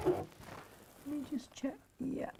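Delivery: slow whisper to herself. Real, not acted. Sewer checks the stitching underneath in a slow whisper. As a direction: breathe it out, slow and hushed.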